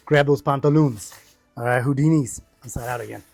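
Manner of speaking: ordinary delivery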